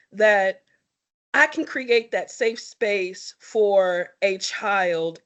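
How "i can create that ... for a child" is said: normal talking voice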